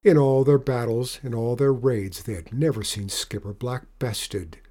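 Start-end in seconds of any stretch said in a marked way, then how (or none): none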